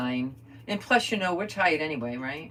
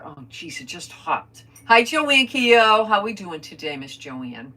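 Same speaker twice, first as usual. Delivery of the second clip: usual speaking voice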